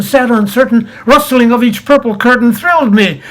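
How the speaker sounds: ordinary talk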